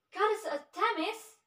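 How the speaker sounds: loud, high cry out to a goddess; shouted call. A narrator's reading. Kino calling the goddess of the forest, shouting. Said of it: "he shouted".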